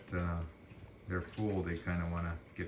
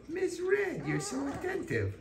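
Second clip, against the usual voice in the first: high puppy talk. Real, not acted. Owner cooing at a puppy in high baby talk. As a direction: coo up high at the pup.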